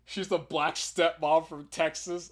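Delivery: usual speaking voice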